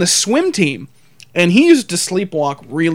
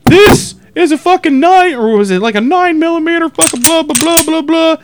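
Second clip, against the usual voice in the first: high, loud hammed-up cop lecturing; mocking the cop; 10 semitones higher, 4 dB louder than usual. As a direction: parody a pompous gun-safety cop. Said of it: "When he was like".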